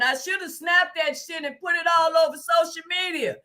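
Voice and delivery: usual speaking voice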